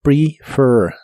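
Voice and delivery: "Prefer" with a short i and a tongue pulled far back on the end; model word. Shown as a demonstration. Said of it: "como I corta".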